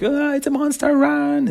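very high mock scream of alarm; hammed-up shrieky panic; panicked cry. Alligator lady flees the monster.

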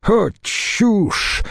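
high-pitched dismissive exclamation; scornful cry. A narrator's reading. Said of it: "выкрикнул Курион". (презрительный выкрик)

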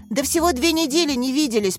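mock-grumpy schoolgirl; grumbling. A narrator's reading. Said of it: "проворчала Наташа".

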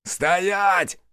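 high-pitched shouted order; drawn-out shout to halt; shouted command. Acted. Pursuing marauders shout at fugitives.

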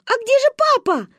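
put-on high, alarmed child's shout; children yelling. A narrator's reading. Children missing their father, alarmed yelling. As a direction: call out in a high panicky voice. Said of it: "орали мы сверху".

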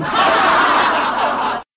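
silent wheezing laugh; breathy laugh. Real, not acted. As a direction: laugh without voice. Laughing at own line.

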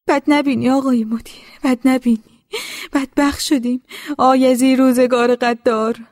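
put-on high, tearful old woman's lament; wailing old woman. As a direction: cry out like a grieving grandmother. Grandmother voiced lamenting bad news.

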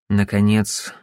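plain reading voice

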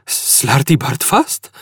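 slow, rising disbelieving echo; incredulous echo. A narrator's reading. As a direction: repeat it as if it can't be. Arthur echoing in disbelief.

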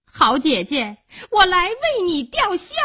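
high, theatrical wailing voice; exaggerated high-pitched funeral cry; mock mourning. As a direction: keep up the sham lament, climbing high. Xiao'e's sham mourning before wife.

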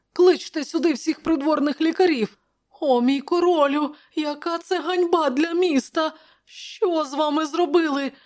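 very high, fretful wail; panicked crying out. A narrator's reading. Counsellor fussing over his wounded king, panicked. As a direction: lament in a high, helpless flurry. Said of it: "закричав Публій".

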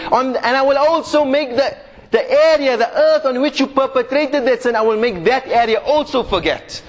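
lightly heightened preacher reading God's words; impassioned quote. Acted. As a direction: proclaim the promise of forgiveness warmly. Quoting Allah's promise.

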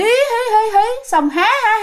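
high "hee hee" turning into "ha ha"; high, spelled-out spooky laugh; imitated ghost laugh. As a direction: mimic an eerie woman's cackle. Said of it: "Bà nói bà cười từ hí".

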